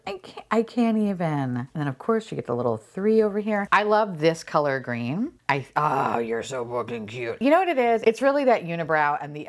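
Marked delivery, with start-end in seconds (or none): none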